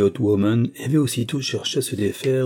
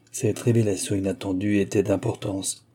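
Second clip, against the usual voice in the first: plain reading voice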